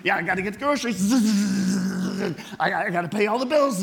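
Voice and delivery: rapid, rising, rushed listing of chores; frantic busy person. Pretend. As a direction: rattle it off breathlessly like you're drowning in tasks.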